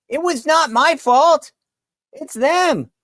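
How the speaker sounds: high, slow mocking excuse; exaggerated high-pitched mock protest; mock blame-shifting. Mocking paraphrase of Harris's excuses.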